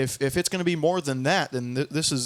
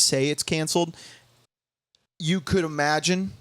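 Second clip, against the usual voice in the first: ordinary talk, nothing marked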